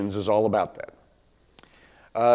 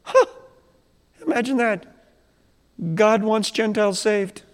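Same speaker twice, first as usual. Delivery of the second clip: higher, hammed-up wonder; mock amazement; 9 semitones higher than usual. Believers react to Gentiles saved, in mock surprise. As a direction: act amazed, half joking.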